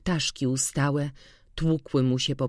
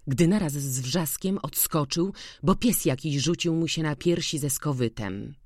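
ordinary delivery

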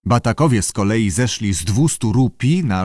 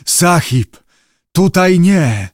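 higher, louder frightened plea; terrified; 6 semitones higher, 5 dB louder, slower than usual. A narrator's reading. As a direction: beg the master, voice raised. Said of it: "zjeżyły się z przerażenia".

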